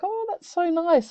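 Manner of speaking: high, slow, touched coo; slow high coo of delight. Shown as a demonstration. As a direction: melt at something sweet. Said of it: "something that's so sweet".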